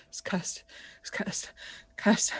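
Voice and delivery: breathless whispered repetition; a traumatised sailor whispering to himself; haunted whisper. Acted. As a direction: whisper it like he's seen a ghost. Shocked gunner mutters to himself.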